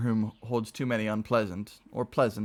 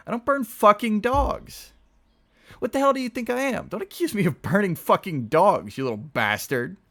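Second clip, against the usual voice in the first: furious shout; 13 semitones higher, 7 dB louder than usual. A narrator's reading. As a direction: scream the accusation back in rage. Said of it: "he started to shout".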